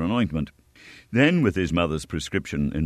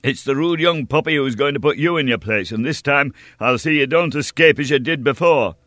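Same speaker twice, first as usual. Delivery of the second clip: higher and louder, hot-headed; defiant challenge; 7 semitones higher, 5 dB louder than usual. A narrator's reading. Young hero defying the stranger, heated challenge. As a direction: throw the insult back in his face.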